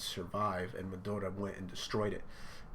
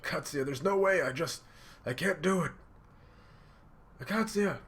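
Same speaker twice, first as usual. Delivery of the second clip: high, anguished protest of a character; acted crying; 8 semitones higher, 4 dB louder than usual. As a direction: plead helplessly, as if in tears. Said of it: "Ichiro is crying".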